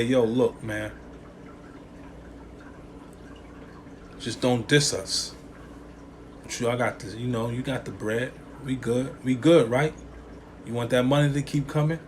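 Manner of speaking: low, hushed, persuasive exec; shady label voice. Retold or imitated. Label pays the rapper to stay quiet, low and hushed. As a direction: coax a star into keeping quiet. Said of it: "they say".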